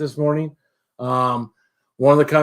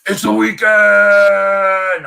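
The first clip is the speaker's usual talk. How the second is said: drawn-out, louder and higher DJ call; radio DJ catchphrase; 5 semitones higher, 5 dB louder, much slower than usual. Retold or imitated. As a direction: belt it like a seventies radio jock. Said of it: "Usually we go".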